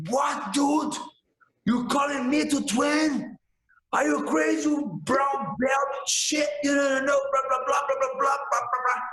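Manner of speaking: high, offended yell at a lowly brown belt; angry shout. Retold or imitated.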